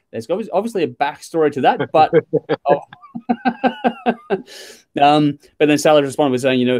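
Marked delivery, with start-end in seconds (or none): 3.1-4.4 high, rippling giggle; real, high burst of laughter. Real, not acted. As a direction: crack up in high, quick chuckles. Amused by viewer's beer comment.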